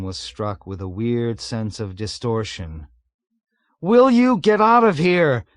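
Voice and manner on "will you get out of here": high, loud pleading yelp; beseeching yelp. A narrator's reading. Pilot yelps beseechingly at navigator. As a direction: yelp it, begging him to go. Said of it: "he yelped beseechingly".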